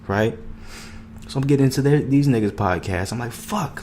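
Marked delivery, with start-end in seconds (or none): 3.3-3.8 amazed curse. Retold or imitated. Amazed reaction, high pitch.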